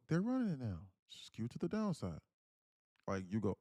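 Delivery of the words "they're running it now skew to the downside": switched-up comment voice. Shown as a demonstration. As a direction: read it as someone else's comment, pitched up. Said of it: "But if I go".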